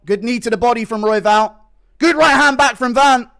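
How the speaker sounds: excited fight commentary; live play-by-play. Real, not acted. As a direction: call the action live.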